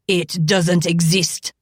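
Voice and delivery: slow, emphatic denial; exclaiming. A narrator's reading. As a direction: stress each word, defiant. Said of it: "Jacques exclaimed".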